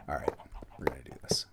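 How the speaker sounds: low whisper to yourself. Real, not acted. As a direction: hush it under your breath. Whispered to himself.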